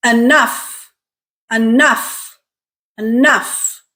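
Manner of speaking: "Enough" with stress on the second syllable, e-NOUGH; careful model "Enough", weak start, strong uh in nough; stressed syllable drill. Shown as a demonstration. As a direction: say "Enough" alone, e-NOUGH, for learners to copy. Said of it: "What syllable is stressed?".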